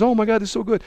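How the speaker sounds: high, gushing mock voice; sarcastic high squeal of delight; mock gushing Kamala Harris. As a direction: gush like an overexcited fan. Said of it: "And Kamala Harris says".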